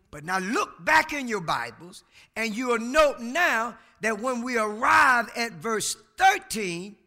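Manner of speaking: usual speaking voice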